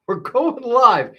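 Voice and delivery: high, loud and drawn out; excited announcement. Real, not acted. Comedian greeting viewers, excitedly calling out going live. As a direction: announce the stream with a burst of hype. Kicking off surprise live stream.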